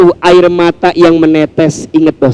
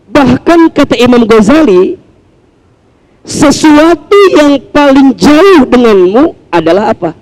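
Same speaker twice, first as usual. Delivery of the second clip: normal talking voice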